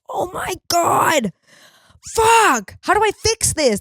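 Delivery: high, loud panicked cry; frantic mock wail, voice cracking high. Panicking over her slip.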